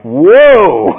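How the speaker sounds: loud, high, drawn-out whoa; shocked. Acted. Readers hit by Paul's harsh language, shocked. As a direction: reel as if just hit. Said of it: "these people are just in shock".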